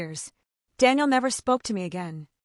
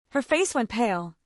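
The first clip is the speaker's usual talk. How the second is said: ordinary delivery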